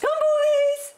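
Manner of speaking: high, loud call across a field; alpaca calling voice. Shown as a demonstration. Alpaca owner calling her herd across the field, high loud call. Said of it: "Do you have a voice?".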